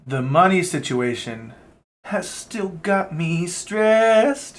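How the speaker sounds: an embarrassing high, slow stretch on stressed; stressed pulled out high and slow, rising at the end; botched take. Real, not acted. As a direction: pitch jumps way up and the words drag out slowly. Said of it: "That was embarrassing".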